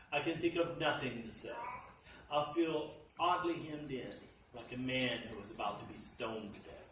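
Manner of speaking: plain reading voice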